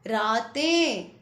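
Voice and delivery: रातें stretched slowly, nasal ending ें clearly heard; slow nasal plural. Shown as a demonstration. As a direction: draw out रातें to show the nasal. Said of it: "हमें उसको बोल कर देख लेना है". (रातें धीरे बोलकर बिंदी सुनाना)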